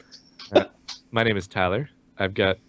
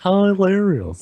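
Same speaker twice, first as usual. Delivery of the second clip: high drawn-out hilarious; 8 semitones higher, 4 dB louder, slower than usual. Shown as a demonstration. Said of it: "repeated word game".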